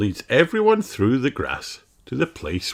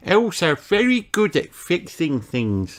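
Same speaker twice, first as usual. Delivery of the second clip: put-on elf boy voice; 10 semitones higher than usual. A narrator's reading. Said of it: "says Barnaby".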